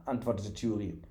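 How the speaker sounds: usual speaking voice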